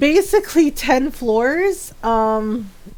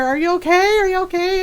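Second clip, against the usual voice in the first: high, worried mock yell; retold yelling; 8 semitones higher, faster than usual. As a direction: re-enact yelling out to her, pitched way up. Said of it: "I'm yelling at her".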